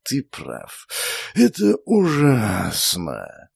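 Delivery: slow, soft mournful serpent sigh; plaintive near-whisper. Acted. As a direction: breathe it out plaintively. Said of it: "Жалобно вздохнув, почти прошептал змей".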